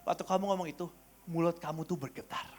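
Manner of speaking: soft, low-key voice; quiet confiding. Retold or imitated. Brother pointing out a trembling mouth, quiet. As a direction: observe it gently and quietly. Quotes Joshua's gentle observation.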